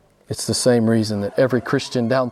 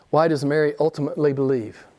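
ordinary delivery

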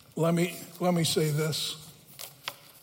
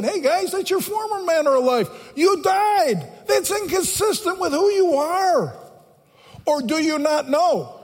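much higher-pitched preaching plea; emphatic exhortation; 9 semitones higher, 3 dB louder than usual. Acted. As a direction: drive the point home to the congregation. Preacher voices Paul exhorting the believers.

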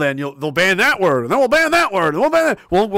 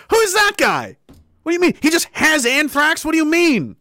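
high-pitched, hammed-up bewilderment; incredulous questioning; 7 semitones higher than usual. Baffled teenager questioning the official story, high-pitched incredulity. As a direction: demand answers in baffled disbelief.

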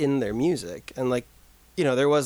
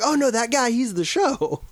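surprised; 10 semitones higher, 4 dB louder than usual. Retold or imitated. Realizing it's [name].

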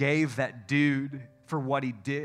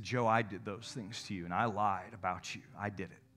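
hushed apology; 7 semitones lower, 9 dB quieter than usual. Retold or imitated. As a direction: confess it quietly as the offender. Friend voices abuser's apology.

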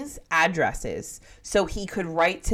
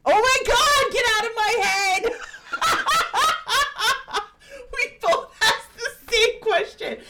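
laughing. Real, not acted. Both asked the same question.

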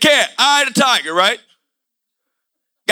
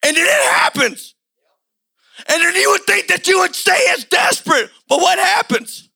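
preaching at full force; 4 semitones higher than usual. Acted. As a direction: drive it home like a revival preacher. Preaching climax.

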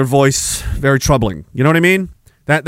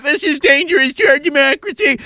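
higher, prissy mock alarm; mock whine; 10 semitones higher than usual. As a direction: parody a timid politician. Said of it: "you went".

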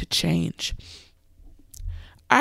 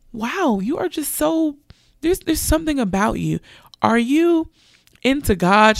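amazed stranger; 8 semitones higher than usual. Retold or imitated. Stranger amazed, asks about God.

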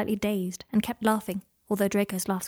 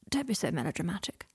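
murmured; 2 semitones lower, 6 dB quieter than usual. A narrator's reading. Patient brushing off his visitor's alarm, murmured. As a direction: murmur it half under the breath. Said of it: "murmured Draco".

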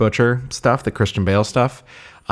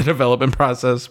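ordinary talk, nothing marked

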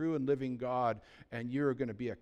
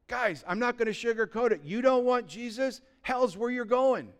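higher, firm warning to the crowd; don't sugarcoat it; blunt preaching; 8 semitones higher, 5 dB louder than usual. Acted. Pastor warning the church bluntly, raised voice.

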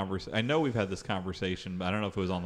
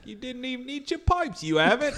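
scolding parent voice; 4 dB louder than usual. Acted.

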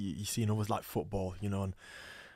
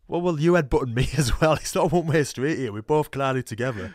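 raised, higher voice pushing back; retold protest; 6 semitones higher, 11 dB louder than usual. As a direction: protest loudly, half laughing. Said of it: "I went".

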